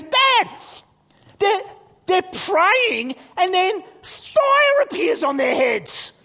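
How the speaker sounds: loud, high, slow-stretched astonishment; excited child. Retold or imitated. Son telling dad about Acts, loud and high with excitement. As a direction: play a kid bursting with news.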